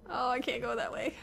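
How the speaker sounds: ordinary talk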